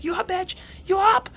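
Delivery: very high shouted wake-up call. Retold or imitated. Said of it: "yelling a bit louder".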